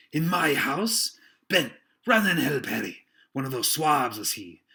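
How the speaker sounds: loud, slow furious pirate; angry shout. Acted. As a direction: bark orders across the room. Silver shouts orders.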